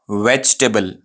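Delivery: "वेजिटेबल" shortened to VECH-tuh-bul; correct pronunciation. Shown as a demonstration. Teacher introduces the next word, "वेजिटेबल" as vech-tuh-bul. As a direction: drop the middle "i" in "वेजिटेबल". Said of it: "सही pronunciation है".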